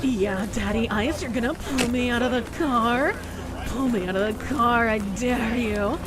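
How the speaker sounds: lightly voiced teasing woman; breathy dare, coy and taunting; flirty woman. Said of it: "the women are coming here like".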